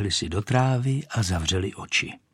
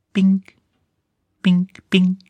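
high, loud, short pings; gunpowder popping; 10 semitones higher, 6 dB louder, slower than usual. A narrator's reading. Gunpowder goes off in the rocket, sharp pinging. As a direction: pop each ping out high and sharp. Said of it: "Udělal střelný prach".

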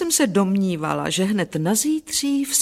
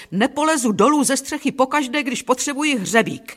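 raised pitch, indignant refusal; lightly acted exasperation; exasperated complaint; 7 semitones higher than usual. A narrator's reading. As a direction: grumble at a helper with rising impatience. Husband complains while ordering wife about.

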